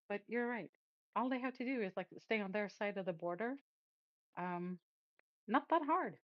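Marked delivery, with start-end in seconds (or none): none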